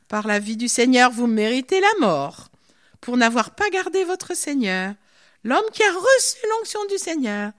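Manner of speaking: réprimande déclamée. Acted. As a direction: proclaim the sentence at full volume. David berates Abner from afar. (réprimande solennelle, forte et traînante)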